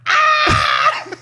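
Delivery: high-pitched yell of pain, far up; a sudden piercing scream as the belt hits; retold scream. Young son getting hit by his mom, loud pained scream. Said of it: "dude, I was like".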